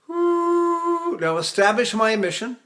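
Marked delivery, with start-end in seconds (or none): 0.1-1.2 high ooh on leaking breath; leaky falsetto ooh. Shown as a demonstration. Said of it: "So I breathe behind me".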